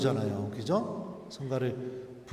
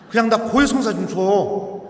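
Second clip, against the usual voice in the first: louder insistent plea; 5 semitones higher, 7 dB louder than usual. Retold or imitated. Insisting on confession.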